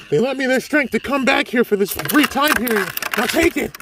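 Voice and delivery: high urgent spirit voice; high-pitched ally voice. Acted. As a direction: plead with him to take the power before you fade.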